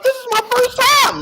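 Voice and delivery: high-pitched squealed imitation; mock scream. Imitating what Bone screamed out, high mock panic. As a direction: wail it out in hammed-up panic.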